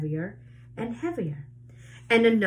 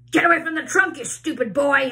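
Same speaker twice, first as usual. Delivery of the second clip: put-on loud, higher angry yell at the boy; 7 semitones higher, 7 dB louder, faster than usual. A narrator's reading. Aunt Spiker yells at James to leave the trunk, loud and angry. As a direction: bark it at James. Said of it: "Aunt Spiker yelled".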